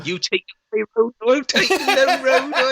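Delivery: high, laughing Scottish lilt; badly faked Scottish, played for laughs; mock Scottish accent. Mocking a supposed Scot, high Scottish accent. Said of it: "with a Scottish accent".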